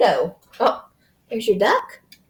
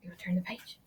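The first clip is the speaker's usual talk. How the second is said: usual speaking voice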